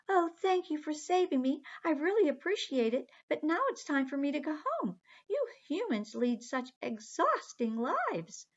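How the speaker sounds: high, sweet little mermaid. Acted. Aqua the mermaid politely telling the children she must go home, high voice. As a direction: lift the voice up high for the tiny mermaid. Aqua thanks her rescuers.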